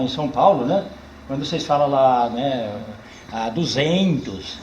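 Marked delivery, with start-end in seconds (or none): 3.3-4.6 slow duzentos with a stretched I, like duzentio; caipira drawl. Shown as a demonstration. Storyteller shows the caipira way of saying numbers. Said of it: "esse I será que tem".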